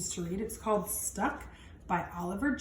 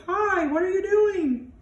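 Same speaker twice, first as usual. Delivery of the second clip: friendly whale voice; 9 semitones higher, 8 dB louder, slower than usual. Acted. Whale speaks in story.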